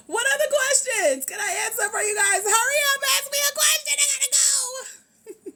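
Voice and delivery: high, loud, urgent yelling; hurried shouting. Real, not acted. Host rushing viewers for questions, high urgent shout. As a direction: holler at them to hurry up.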